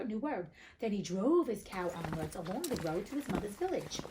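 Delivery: plain reading voice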